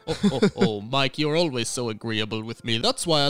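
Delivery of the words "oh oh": usual speaking voice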